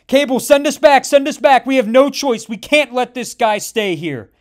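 high and loud, urgent repeats; panicked shout. A narrator's reading. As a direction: beg at full volume, cooling toward the end. Panicked plea facing the mutant.

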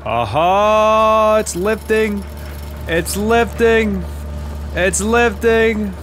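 thrilled high shouts; excited. Real, not acted. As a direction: cheer it on, stretched out. Gauge rising, excited cheering.

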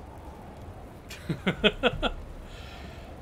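breathy relieved laugh; relieved laughter. Real, not acted. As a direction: laugh it off softly. Laugh after victory.

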